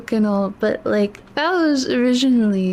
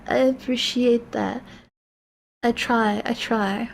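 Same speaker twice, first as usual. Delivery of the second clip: fading teary voice; 5 semitones lower, 4 dB quieter, slower than usual. Real, not acted. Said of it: "not strong all the time".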